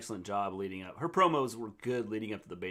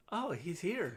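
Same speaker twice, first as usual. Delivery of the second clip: higher, eerie sing-song "oh, he's here"; imitating a sudden eerie turn; creepy switch; 8 semitones higher, 3 dB quieter, slower than usual. Host mimics Alexa turning eerie. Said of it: "she turns from staring Nikki down to".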